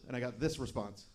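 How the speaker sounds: ordinary delivery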